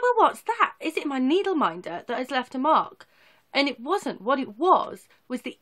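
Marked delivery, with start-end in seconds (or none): 0.0-3.1 puzzled. Retold or imitated. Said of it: "I was like".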